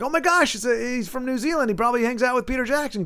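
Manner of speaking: high, quickening mock amazement; retold excitement. Host recalls hearing a churchgoer's accent, acted excitement. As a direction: act out an excited realization, pitch up.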